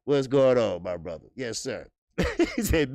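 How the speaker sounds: ordinary delivery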